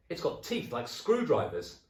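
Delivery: loud put-on alarm; mock-alarmed old man. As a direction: scare her on purpose. Husband scaring wife.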